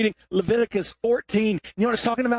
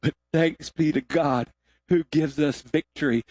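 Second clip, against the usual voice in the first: scripture reading; 4 semitones lower than usual. Acted.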